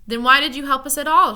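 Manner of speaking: loud, high angry demand; angry shout. A narrator's reading. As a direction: shout at him in anger. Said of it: "shouted Milo angrily".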